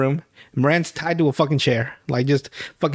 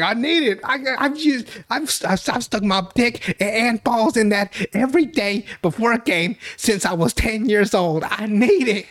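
high, whiny begging; hammed-up high pleading; desperate Thad; 7 semitones higher than usual. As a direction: beg like a junkie for his fix. Thad pleading for his ritual.

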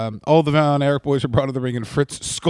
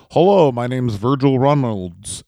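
ordinary talk, nothing marked

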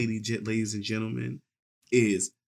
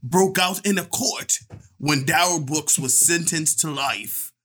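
bad English accent. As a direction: read it grandly in a dubious English accent. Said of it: "Use your English accent".

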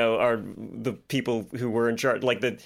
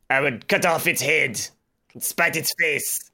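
mock French accent; 3 semitones higher than usual. Co-host rails at the clock in a French accent. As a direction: declaim like a French revolutionary in a French accent. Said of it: "the more ridiculous accents you can do".

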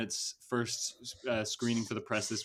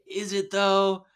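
skeptical; 8 semitones higher, 7 dB louder, slower than usual. Retold or imitated. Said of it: "we were like".

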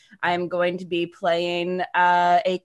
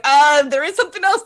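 higher, louder burst to cut in; eager interruption; 5 semitones higher, 9 dB louder than usual. Retold or imitated. Cutting in while Thomas talks, eager and loud. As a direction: jump in eagerly over someone. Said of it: "I was like".

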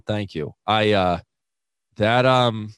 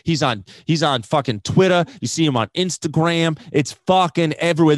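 normal talking voice

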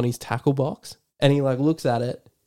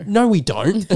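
quick denial; 7 semitones higher, 3 dB louder than usual. Retold or imitated. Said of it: "Harrison's like".

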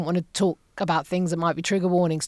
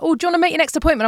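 higher, fast, breezy receptionist; chirpy receptionist; 8 semitones higher, 4 dB louder, much faster than usual. Retold or imitated. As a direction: rattle it off like a cheery front desk. Receptionist voiced in retold scene.

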